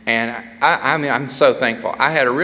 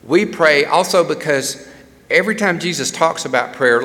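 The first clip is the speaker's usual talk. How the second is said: normal talking voice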